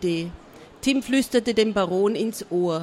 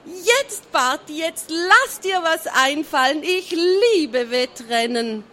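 gleeful king; 6 semitones higher than usual. A narrator's reading. The king gloating with glee.

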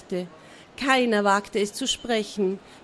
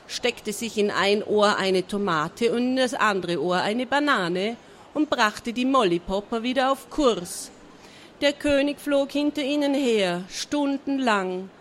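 ordinary delivery

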